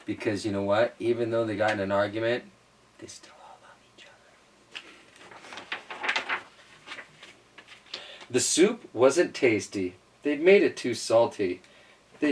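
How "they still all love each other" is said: whisper. Real, not acted. Whispered aside to child.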